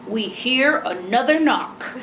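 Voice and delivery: slow, silly storybook voice; silly changed voice. Shown as a demonstration. Mother gets silly so child will match, slow put-on voice. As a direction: draw it out in a playful put-on voice. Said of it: "change my voice a little bit".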